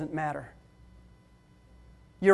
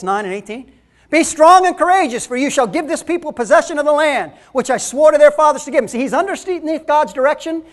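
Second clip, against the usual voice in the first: loud, high fiery preaching; preaching at full force; 4 semitones higher, 8 dB louder than usual. Acted. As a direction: preach it hard to the congregation. Preaching loud about Joshua.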